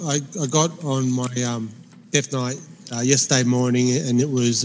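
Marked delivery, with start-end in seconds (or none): none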